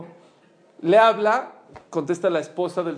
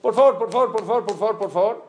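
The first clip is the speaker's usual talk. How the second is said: louder, higher begging, pressing hard; desperate pleading; 4 semitones higher, 6 dB louder, faster than usual. Retold or imitated. Buyer begs the shop again, louder and higher. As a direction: beg like the deal depends on it.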